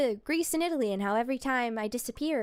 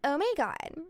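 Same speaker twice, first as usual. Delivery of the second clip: put-on Valley girl accent. Streamer re-enacting her airport bit, Valley girl accent. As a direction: gush like a ditzy Valley girl.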